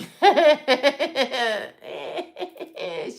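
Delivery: long burst of real laughter. Real, not acted. To her chat about backseat drivers, a real burst of laughter. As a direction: break out laughing.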